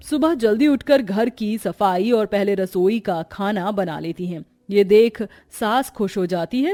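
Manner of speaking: ordinary delivery, nothing marked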